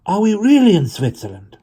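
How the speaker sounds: high, loud, thrilled "really"; a girl who can't believe they've escaped; excited disbelief. A narrator's reading. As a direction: ask it in delighted wonder.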